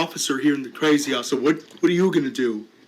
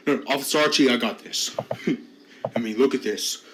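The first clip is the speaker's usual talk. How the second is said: ordinary talk, nothing marked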